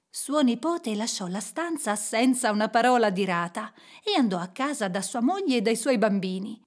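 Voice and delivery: plain reading voice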